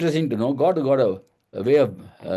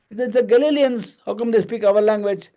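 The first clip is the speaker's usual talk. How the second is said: higher-pitched mock astonishment; acted amazement; 8 semitones higher than usual. Crowd from across the empire wondering at Galileans' speech, acted amazement. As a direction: marvel aloud as the amazed crowd. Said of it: "Utterly amazed, they asked".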